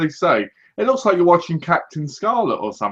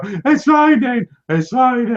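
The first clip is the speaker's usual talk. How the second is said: high, loud and slow delighted cry; mock joy; 8 semitones higher, 3 dB louder, slower than usual. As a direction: celebrate the rain, drawn out. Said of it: "They just go".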